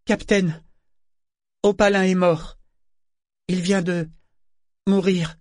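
voix de garçon bien plus aiguë et plus forte; garçon désespéré. A narrator's reading. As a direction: annoncer une mort, au bord de la panique. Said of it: "sur un ton désespéré". (le mousse annonce la mort d'Opalin, voix aiguë paniquée)

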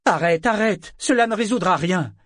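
high, loud pleading shout; crying out. A narrator's reading. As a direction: cry out to break up the fight. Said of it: "alors que Kefria criait".

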